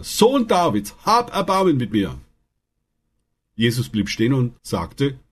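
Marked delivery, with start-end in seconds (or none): 0.0-2.2 deutlich höherer, drängender Ruf; noch lauter gerufen. A narrator's reading. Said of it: "rief er nur noch lauter". (Bettler ruft trotz Schweigegebot höher und drängender)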